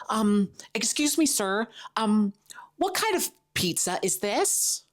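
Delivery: put-on high, hesitant little-kid voice; imitated polite kid. Kid asking a New York stranger about pizza, high and hesitant. Acting out herself as a child.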